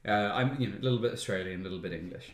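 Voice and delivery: low, soft Australian-English accent; understated Australian-English voice; regular accent. Shown as a demonstration. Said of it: "my regular voice sounds like this".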